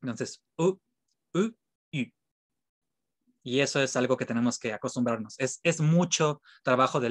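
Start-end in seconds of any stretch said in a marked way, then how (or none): 0.6-2.1 "U" with rounded lips, French u; French rounded u sound; French u and eu demo. Shown as a demonstration. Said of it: "ponen la boquita en forma de I y pronuncian U".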